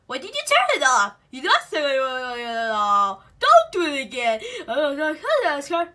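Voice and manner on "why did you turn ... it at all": ordinary talk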